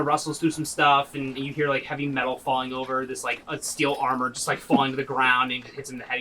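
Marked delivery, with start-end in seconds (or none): none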